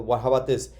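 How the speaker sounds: normal talking voice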